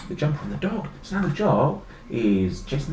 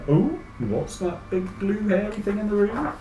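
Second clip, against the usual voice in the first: lightly voiced puzzled little one, higher pitch; quizzical baby; 7 semitones higher than usual. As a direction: wonder aloud as a baffled baby. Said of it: "a bit quizzical".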